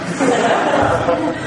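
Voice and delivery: a quick high burst of laughter; brief real chuckle; short laugh. Real, not acted. Laugh alone after a remark.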